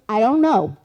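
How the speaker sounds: loud, emphatic mock brush-off; exasperated retort. Storyteller acts out psychic dismissing a question, loud and exasperated. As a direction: throw it back loudly.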